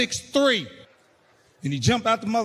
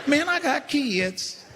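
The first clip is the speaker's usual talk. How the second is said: scared tough guy; 7 semitones higher, much slower than usual. Retold or imitated.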